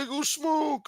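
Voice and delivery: high squeaky mock voice; mock quote. Quoting a guy stepping out to smoke, mock high. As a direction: toss it off in a silly high voice. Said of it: "But if you're like".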